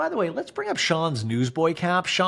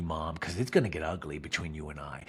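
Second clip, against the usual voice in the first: low warning; 10 semitones lower, 8 dB quieter than usual. Retold or imitated. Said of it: "And Monica's like".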